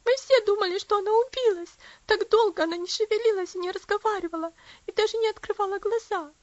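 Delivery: high loud tearful telling. A narrator's reading. Lucy tells Herbert what happened, tearful, high and loud. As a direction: keep crying as you tell it.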